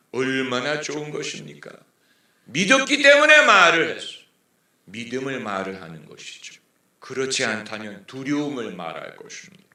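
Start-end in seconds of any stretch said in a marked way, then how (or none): 2.4-4.2 the verse shouted high and loud from the pulpit; the preacher booms the verse back at full force; preaching shout. Acted. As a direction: hurl the words out to the congregation.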